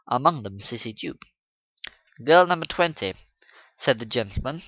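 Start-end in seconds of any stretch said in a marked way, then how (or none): 2.2-3.1 calm, smiling authority. Acted. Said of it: "smiling in the calm strength".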